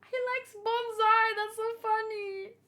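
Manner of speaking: delighted squeal. Real, not acted. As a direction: squeal it, tickled by the news. Delighted reaction to Oda's hobby.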